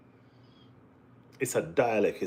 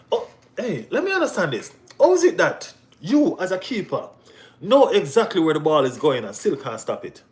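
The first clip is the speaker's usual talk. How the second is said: incredulous; 5 semitones higher, 4 dB louder than usual. Real, not acted. Mocking the keeper who conceded.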